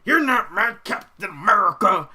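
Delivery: loud, high hammed-up outburst; mock angry yell. Imitating Twitter critics of a comic, mock outraged yell. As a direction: yell it like an outraged fan, exaggerated.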